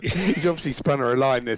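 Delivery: usual speaking voice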